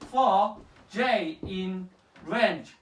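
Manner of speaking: each word drawn out, very slow; slow dictation. Real, not acted. Tutor dictating a for loop to Eric, very slow. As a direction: spell the code out slowly so he can type it.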